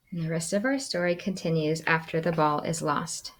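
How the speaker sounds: plain reading voice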